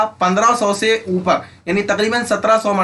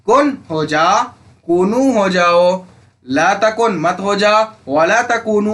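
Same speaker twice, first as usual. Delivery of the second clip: slow drill; slower than usual. Shown as a demonstration. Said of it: "teacher drilling the forms slowly".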